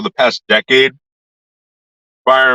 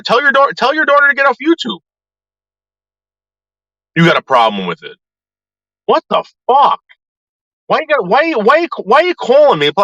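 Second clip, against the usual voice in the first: higher, heated address to the mother; angry rant; 10 semitones higher than usual. Real, not acted. Yelling at Camilla's mother about the calls. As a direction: vent straight at her, indignant.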